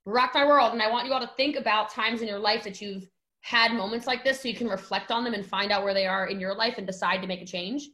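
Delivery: ordinary delivery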